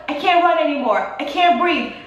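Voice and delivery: mock out-of-breath panic, lightly acted; almost crying. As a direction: plead that you can't go on. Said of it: "I was almost crying".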